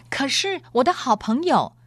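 high-pitched, mildly loud exclamation; big-voiced protest. A narrator's reading. Noblewoman showing off to her hushing friend, big-voiced and high. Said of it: "他仍然用他的大喉咙说话".

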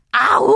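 long rising animal howl; coyote howl. A narrator's reading. Coyote shows the boy his singing, howling. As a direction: throw out a drawn-out howl. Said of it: "叫着".

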